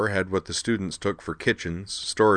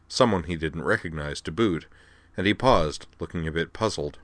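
plain reading voice

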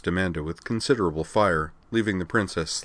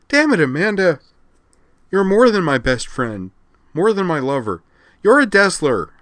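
loud, high, heated declaration; impassioned outburst; 10 semitones higher, 7 dB louder than usual. A narrator's reading. Rena declares her devotion to Amanda, impassioned and loud. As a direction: pour it out with exasperated force.

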